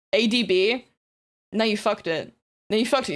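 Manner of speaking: usual speaking voice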